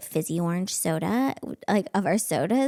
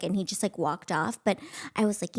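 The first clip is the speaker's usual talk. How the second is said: ordinary talk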